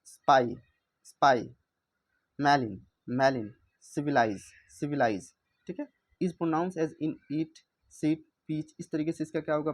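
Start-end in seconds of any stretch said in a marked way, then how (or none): 0.0-5.3 स्पाई and सिविलाइज loud, with a clear AI vowel; long I sound. Shown as a demonstration. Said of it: "आई की तरह से pronounce करना है".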